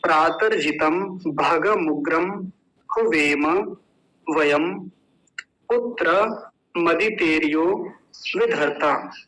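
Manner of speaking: slow Sanskrit line, word by word; verse recitation. Shown as a demonstration. Teacher reciting the first line for students, slow recitation. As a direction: recite the verse slowly, pausing between words. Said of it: "तो पहली पंक्ति हो गई".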